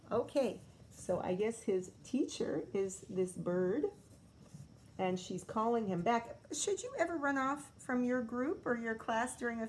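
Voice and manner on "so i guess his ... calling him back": usual speaking voice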